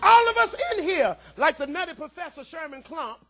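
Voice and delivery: loud, high-pitched sermon climax, shouted; preaching at full force. Acted. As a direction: whoop it up to the pews.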